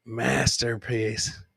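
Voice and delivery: normal talking voice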